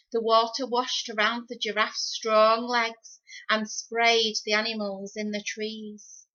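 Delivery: ordinary delivery, nothing marked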